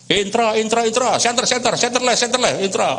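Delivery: high, rapid-fire repeated destination calls; bus tout. Acted. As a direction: call out for passengers like a terminal tout. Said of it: "banyaklah calo-calo".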